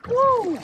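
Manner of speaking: high, loud woo; whoop. Real, not acted. Angler cheering his huge flathead, high and loud. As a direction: cheer the catch. Weighing the fish.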